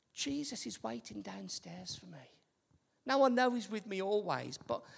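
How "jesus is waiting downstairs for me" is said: whispered thought. Retold or imitated.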